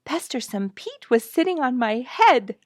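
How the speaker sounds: bursting with news of the bird; excited child. Acted. Girl telling her mother about the bird, excited.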